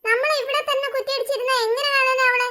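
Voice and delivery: a nervous sidekick pleading his point; lightly voiced jittery friend; anxious buddy voice. Friend character voice.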